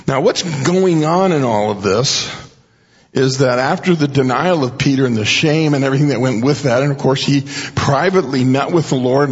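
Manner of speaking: normal talking voice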